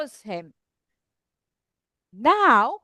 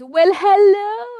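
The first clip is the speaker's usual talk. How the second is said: high, loud, long-drawn owl greeting; wailing storybook owl; hooting owl; 6 semitones higher, 8 dB louder, slower than usual. Acted. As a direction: stretch the second word out like a hoot. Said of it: "the owl comes down and says".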